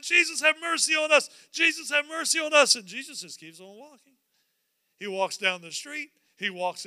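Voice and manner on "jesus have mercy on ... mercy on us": loud, raised, repeated plea; crying out. Acted. Blind men following Jesus down the street, crying out. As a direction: keep hollering after him. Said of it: "crying out".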